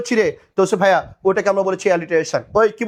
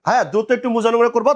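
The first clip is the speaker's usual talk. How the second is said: ordinary talk, nothing marked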